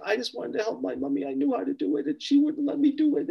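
very high imitated little-girl wail; tearful child. Little girl who wanted to help mummy, imitated tearful protest. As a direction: plead in a high child voice, as if crying. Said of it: "she's crying and crying and crying".

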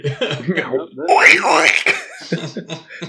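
loud, high honking like a goose. Pretend. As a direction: honk out a goose call. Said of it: "That was my goose impression".

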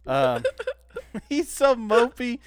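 normal talking voice

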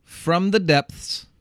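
"depths" with every sound spelled out, slowly; careful enunciation. Shown as a demonstration. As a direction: say "the depths" slowly, hitting each consonant. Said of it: "It's a weird word to try to say every part of".

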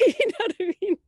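laughing to the host about the cursed play. Real, not acted. Laughs after cursed play remark.

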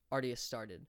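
plain reading voice

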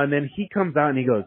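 ordinary delivery

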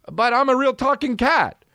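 high, indignant mock plea; protesting talking cat. Talking cat objects to losing the role, high protest. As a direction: whine your case as a passed-over cat. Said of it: "A real talking cat would be like".

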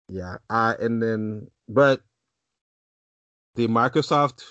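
usual speaking voice

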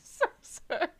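high, voiceless wheeze of an apology; helpless laughter. Real, not acted. Host apologising to co-host, wheezing with laughter. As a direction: squeeze it out while laughing.

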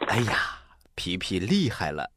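usual speaking voice

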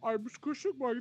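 high-pitched imitation of her crying; squished cheeks. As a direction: mimic a squeaky whiny sob. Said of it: "I wanna do it again".

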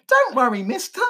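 put-on high Victorian child. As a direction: pitch it up high like a young urchin. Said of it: "child from the eighteen hundreds".

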